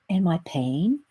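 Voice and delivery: low, hushed, with dramatic pauses; drawn-out comedian's delivery. Retold or imitated. Comedian retelling how his toothache vanished, drawn out. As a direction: imitate the comic, stretching out each phrase softly. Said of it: "that's how he said it too".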